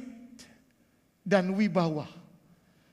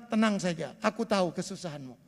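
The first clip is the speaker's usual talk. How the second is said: soft, low voice of God comforting, a little quick; whisper-close comfort for a struggling believer; God's gentle reassurance; 7 semitones lower, 8 dB quieter than usual. Retold or imitated. God reassuring the suffering congregation, soft and tender. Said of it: "Tuhan bilang".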